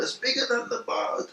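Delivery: high, strained shout at reduced volume; retold shout. Recalling himself calling to the other boat, held-back high shout. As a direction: mimic a distant shout in a high voice. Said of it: "you hear me shouting".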